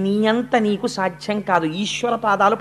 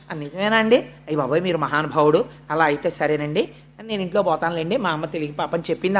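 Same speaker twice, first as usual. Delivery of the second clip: a hint of a timid small boy, lower and softer; meek child; 7 semitones lower, 5 dB quieter than usual. Boy backing down before the sage, lightly played meek voice. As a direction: give in meekly, lightly voiced.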